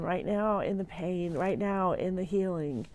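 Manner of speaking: ordinary talk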